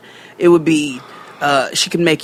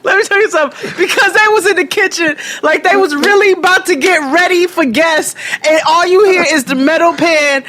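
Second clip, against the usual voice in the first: excited retelling; 10 semitones higher, 3 dB louder than usual. Real, not acted.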